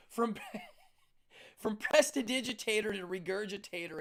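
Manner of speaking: laughing through the punchline; amused wordplay with a chuckle; giggling delivery. Real, not acted.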